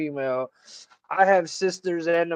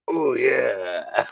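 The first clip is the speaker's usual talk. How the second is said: Kool-Aid Man; 5 semitones lower than usual. Retold or imitated. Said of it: "what does the Kool-Aid Man say".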